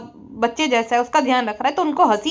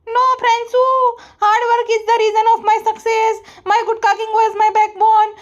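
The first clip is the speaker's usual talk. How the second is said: mock vlogger voice; 8 semitones higher than usual. Roaster mocks the vlogger boasting about success, high smug voice. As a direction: puff up and brag in a squeaky put-on voice.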